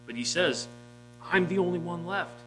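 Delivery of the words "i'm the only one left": higher, panicked cry; a frantic lone prophet; freaking out. Acted. Elijah insists he alone remains, panicking. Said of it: "He's freaking out".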